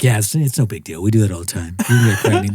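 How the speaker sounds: low, spaced-out doctor voice; coked-out doctor. Retold or imitated. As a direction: lightly play a wired, strange doctor. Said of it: "he sounds like he's coked out of his mind".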